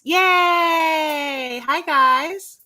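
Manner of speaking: high, loud cheer; bright squeal of yay. Real, not acted. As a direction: throw up a big happy yay. Cheering at show intro.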